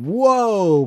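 a loud, high, overplayed whoa; hammed-up whoa. Reading fanfic aloud to cohost, a loud mock whoa. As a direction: blurt it like a startled cartoon.

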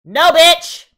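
loud high protest at a character; shouting at the screen. Real, not acted. Viewer yelling at a character on screen, outraged. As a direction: yell at the character to refuse.

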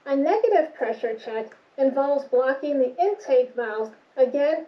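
muffled behind mask; covered mouth and nose; speaking through a respirator. Real, not acted. Seen 5: Speaker wears a dual-cartridge respirator over mouth and nose throughout.

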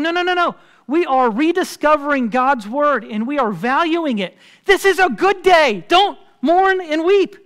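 loud, high preacher's cry, slowing at the end; urgent exhortation. Acted. Leaders correcting the mourning people, urgent exhortation. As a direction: call out over a weeping crowd, building in force.

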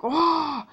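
short high yelped wah; mock wah yell. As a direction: yelp it out like a cartoon sailor. Kid mimics a ship yelling.

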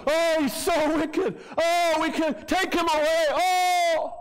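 high, slow, hammed-up wail; mock wailing. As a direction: overplay fake grief over Jesus. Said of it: "they're going".

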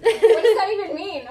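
baffled question through laughter; real laughing. Real, not acted. Guesser puzzled by the silly phrase, laughing.

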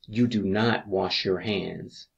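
usual speaking voice

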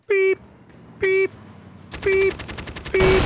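mock reversing beep. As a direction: beep like a backing-up truck. Imitating a reversing truck.

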